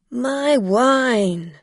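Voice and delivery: loud cry. Acted. Drunk man cries out at his broken bottle, loud. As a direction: wail for the spilled bottle. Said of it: "the man cried".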